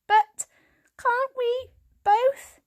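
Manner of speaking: high, slow, desperate plea; young cat pleading. A narrator's reading. Young cat begging his grandfather, high and pleading. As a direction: beg to stay together, drawn out.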